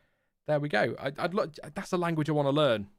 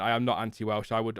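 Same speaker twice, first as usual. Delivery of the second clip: ordinary delivery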